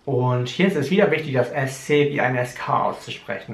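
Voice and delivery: ordinary delivery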